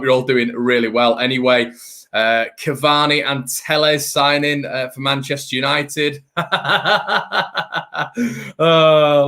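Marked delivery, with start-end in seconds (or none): 6.3-8.5 mocking laugh. Real, not acted. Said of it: "let's all laugh".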